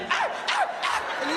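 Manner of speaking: imitated bark. [name] bark sound.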